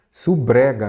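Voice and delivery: "subrega" blended as su-BRE-ga; run-together misreading. Shown as a demonstration. Teacher showing students the wrong reading, "subrega" run together as su-bre-ga. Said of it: "E você falaria subrega".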